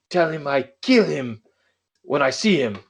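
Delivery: imitating a Greek father's threat in accent. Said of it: "that's a great Greek accent".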